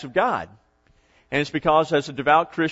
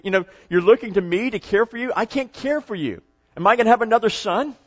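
raised, insistent mock exasperation; exasperated protest; 5 semitones higher than usual. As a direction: plead your case in a higher pitch, incredulous. Acted Naomi rebuffs following daughters-in-law.

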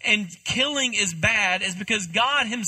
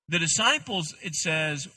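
usual speaking voice

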